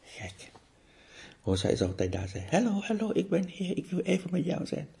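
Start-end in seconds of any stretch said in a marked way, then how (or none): 2.5-4.9 high, sing-song greeting from anger; anger's voice. Pretend. Anger knocking on your door calls out to you, in a high sing-song voice. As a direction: call out cheerfully as the anger itself.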